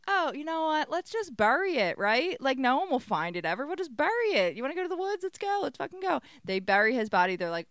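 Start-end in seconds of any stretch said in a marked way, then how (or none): 0.1-6.2 high, rising mock enthusiasm; hammed-up eagerness. Recapper voicing two women planning a burial, mock eager. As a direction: egg them on in a high retelling voice. Acting out Jen and Judy scheming.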